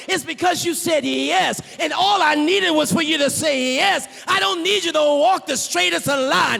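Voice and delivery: loud, soaring preacher's voice of God; preaching as God. Acted. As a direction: thunder God's promise to the congregation. God's voice, preached at full force.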